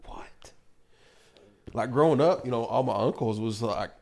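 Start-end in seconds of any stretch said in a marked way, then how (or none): 0.1-0.5 a breathless, shocked little what; stunned whispered what. Retold or imitated. Hearing dad's part in the killings, stunned whisper. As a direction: whisper it in disbelief.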